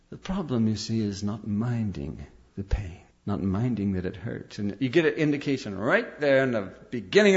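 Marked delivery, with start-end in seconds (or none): 0.1-4.6 lightly voiced, softer and lower Lawrence; a hint of the cool Peter O'Toole calm; Peter O'Toole's classic style. Preacher quotes Lawrence's calm reply, softer and lower. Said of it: "in his classic style".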